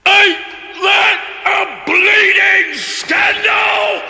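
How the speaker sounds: dying cook shouting at his fate; outraged shout. Acted. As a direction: bellow the outrage.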